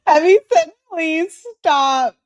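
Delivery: high, loud, through giggles; laughing. Real, not acted. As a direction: read it out while cracking up. Reads comment while cracking up.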